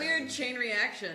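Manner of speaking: ordinary delivery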